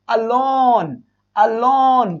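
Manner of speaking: "alone" with a detached first A, second syllable stretched; teacher's slow, emphatic "alone"; separate A sound. Shown as a demonstration. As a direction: say "alone" as a-lone, drawing out "lone". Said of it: "ये separate ए आता है".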